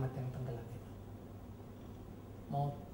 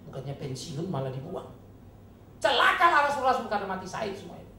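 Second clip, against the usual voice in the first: sarcastic preaching build; 4 semitones higher, 8 dB louder than usual. Acted.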